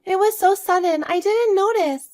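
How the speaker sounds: put-on high little-kid voice; unsure child telling Grandma what happened; young grandchild, bewildered. Plays the grandchild Zoe.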